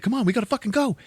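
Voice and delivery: high, hurried-sounding mock plea; urgent urging. Mike urging her off the wing, acted urgency. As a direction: imitate someone urging a getaway. Man urges girl to flee.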